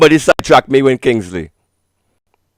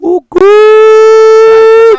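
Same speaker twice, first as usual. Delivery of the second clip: high squeaky falsetto "Oh, good."; mock falsetto; 5 dB louder than usual. Streamer squeals at the radio show in falsetto.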